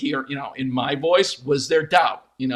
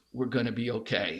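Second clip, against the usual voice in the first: gravelly voice; 6 semitones lower, 6 dB quieter than usual. Retold or imitated. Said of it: "in his gravelly voice".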